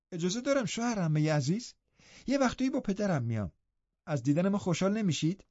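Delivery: put-on high, sweet girlish pleading; young girl coaxing her uncle, pitched high; eager young Catherine.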